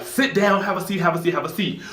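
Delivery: high, hurried "sit down, have a seat"; managers urging. Retold or imitated. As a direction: rush everyone into their seats. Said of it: "they're all like".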